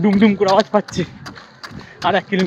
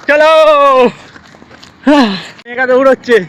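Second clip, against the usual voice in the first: cheering; 5 semitones higher, 4 dB louder than usual. Real, not acted. Cheering on Megha in race.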